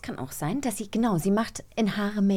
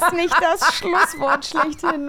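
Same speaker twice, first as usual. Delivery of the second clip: slow, loud, higher words through laughter; laughing; 7 semitones higher, 7 dB louder, slower than usual. Real, not acted. Host laughing at the perfect closing line, slow and loud. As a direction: stretch the line out while laughing hard.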